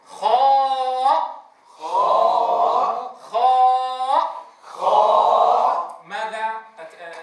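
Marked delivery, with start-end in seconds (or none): 0.1-6.0 each خاء stretched long and loud, heavy and full; drawn-out letter name. Shown as a demonstration. Quran teacher demonstrates خاء to students, long and loud. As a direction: model خاء slowly and strongly. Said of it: "كيف ننطقه؟".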